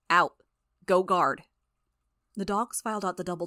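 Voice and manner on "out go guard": loud, forceful commands; barked orders. A narrator's reading. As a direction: bark it like orders to dogs. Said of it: "barking out orders".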